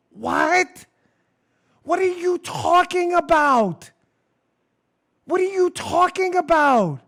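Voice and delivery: disbelief. Real, not acted. Host reacting to headline.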